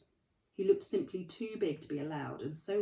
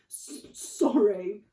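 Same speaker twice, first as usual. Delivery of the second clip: louder, slow, stammered through sobs; sobbed apology; 4 semitones higher, 11 dB louder, much slower than usual. Acted. Hagrid apologising to McGonagall through loud slow sobs. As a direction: choke out the apology while crying. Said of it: "sobbed Hagrid".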